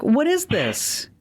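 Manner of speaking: high, slow incredulous question; retold disbelief. Host retelling reaction to a hangar-like garage door, incredulous. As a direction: draw it out high, mock-baffled.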